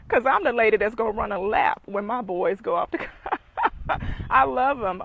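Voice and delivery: loud, laughing declaration; laughing joke. Real, not acted. Joking about a celebration lap when the boys leave, loud and laughing. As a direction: let the laugh carry the joke.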